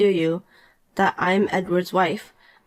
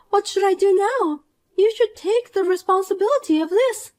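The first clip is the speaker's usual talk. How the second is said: put-on higher, whiny young woman, hurt; Poppy, upset; 10 semitones higher than usual. Rejected Poppy whines to the wife, higher and wounded. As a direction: complain like a spoiled brat who got rejected.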